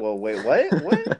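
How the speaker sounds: loud, high disbelief; shocked. Real, not acted. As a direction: shriek out the what. Shocked reaction to joke.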